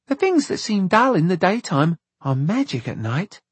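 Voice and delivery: put-on high-pitched child's voice; little girl character.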